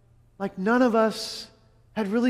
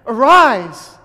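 commanding call; 9 dB louder than usual. Retold or imitated. Jesus commanding the dead man.

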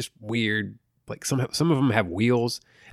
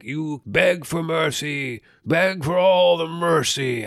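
slow, higher mock villain taunt; slow hammed-up villain taunting the heroine; 7 semitones higher, 5 dB louder, much slower than usual. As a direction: drag out the taunt, slow and mocking. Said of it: "he's taunting her like".

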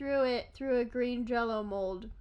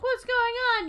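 high, louder confused outburst; baffled; 11 semitones higher, 4 dB louder than usual. Acted. Someone puzzled by the green view, mock baffled.

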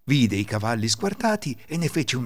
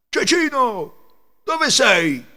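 calling out; 13 semitones higher, 7 dB louder, much slower than usual. Acted. Ladri chiamano nella stalla.

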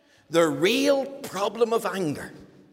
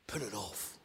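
much softer, low Put it off; soft retort; 11 semitones lower, 15 dB quieter than usual. Acted. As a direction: quietly close the matter. Repeated climax retort.

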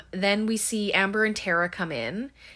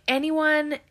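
mock calling out; 9 semitones higher than usual. Acted calling out to hidden people.

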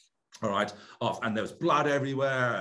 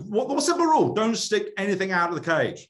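much higher and louder, hammering "Don't" in "Don't stick anything out of the cage"; exasperated emphasis; 10 semitones higher, 6 dB louder than usual. Real, not acted. Telling host about the ex-Marine who ignored the rule, exasperated loud emphasis. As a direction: spell out the obvious rule with disbelief.

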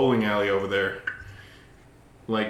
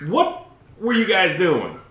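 imitated state trooper; 8 semitones higher, 3 dB louder, slower than usual. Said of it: "It's like".

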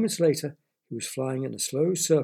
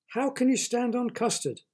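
high-pitched astonished question; surprised; 10 semitones higher than usual. A narrator's reading. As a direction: ask it in amazement, pitch jumping up. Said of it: "Derek gasped in surprise".

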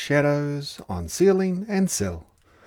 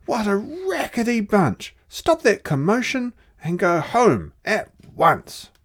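mock angry shout; 9 semitones higher, 3 dB louder than usual. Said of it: "bellowing shouts and a furious roar".

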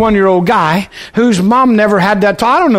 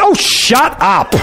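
raised pitch, goading rant; mock provoking scolding; 7 semitones higher than usual. Husband deliberately angering his wife mid-contraction, hammed-up scolding. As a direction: needle her to make her mad. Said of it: "go in there and make her mad".

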